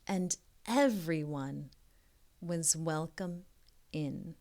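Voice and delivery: slow soft whisper to close; hushed ending. A narrator's reading. Closing the picture book for young listeners, soft hushed ending. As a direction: let the story settle gently.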